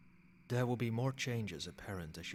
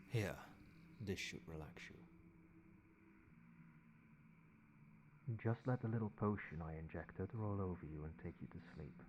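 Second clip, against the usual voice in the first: hushed vampire voice, fading towards sleep; soft lulling; 7 dB quieter than usual. Acted. Vampire sire sedating the fledgling for sleep, soft and hushed. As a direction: lull them under quietly, letting the words drop away.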